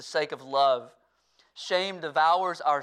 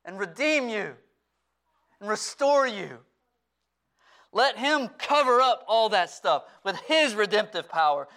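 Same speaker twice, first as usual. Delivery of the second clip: raised, louder preaching voice, pitched up; full-force preaching; 8 semitones higher, 8 dB louder than usual. Acted. Pastor urging his church to bring their past to Christ, full-force preaching. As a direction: preach it out to the whole room with passion.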